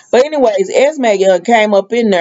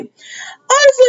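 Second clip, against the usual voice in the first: drawn-out high honestly; much slower than usual. Retold or imitated. Portia scolding Curtis.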